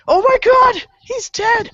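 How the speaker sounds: high, loud shocked shriek; hammed-up scream at a corpse; horrified discovery. Stranger finding the body in the pool, mock shriek.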